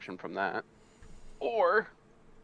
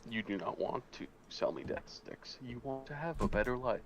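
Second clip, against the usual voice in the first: Jedi mind trick; 3 semitones higher, 3 dB quieter than usual. Retold or imitated.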